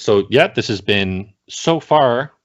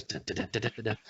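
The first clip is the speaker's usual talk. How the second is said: very soft vocalized jazz drum beat; smooth jazz drumming; 14 dB quieter, faster than usual. Shown as a demonstration. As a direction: tap out a hushed jazz groove with your voice. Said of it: "play some smooth jazz".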